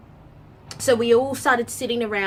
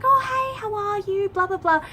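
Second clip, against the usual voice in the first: much higher, bubbly imitation of the Swedish girls; imitating chirpy girls; 4 dB louder than usual. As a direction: squeak it like an overeager new friend. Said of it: "They were like".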